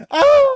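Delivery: loud, high-pitched squeal; re-enacted fan shriek at full volume; excited scream. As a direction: let out a piercing, thrilled "ah". Retelling excited reaction to the wall.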